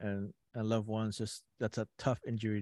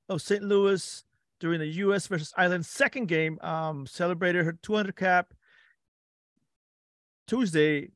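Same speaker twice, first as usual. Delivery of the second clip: higher, louder podcast host voice; show host read; 8 semitones higher, 5 dB louder than usual. Acted. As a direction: open the segment like a radio host. Opening the show segment.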